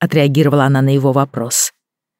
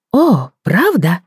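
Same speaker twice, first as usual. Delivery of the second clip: mock surprise; 6 semitones higher, much slower than usual. A narrator's reading. Frankie feigning surprise to child.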